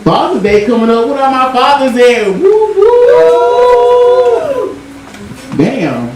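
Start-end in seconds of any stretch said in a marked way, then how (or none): 2.3-4.8 high whooping call. Acted. Calling out to fathers in audience.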